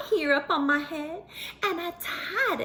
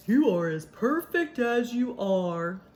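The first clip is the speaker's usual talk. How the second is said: lightly voiced gentle donkey, slow; a hint of a kind donkey friend; Otis the donkey; 5 semitones lower than usual. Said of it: "this is Otis".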